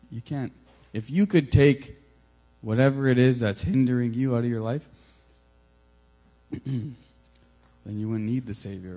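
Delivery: a firm confronting voice from above; God confronting. Retold or imitated. Voicing God confronting him.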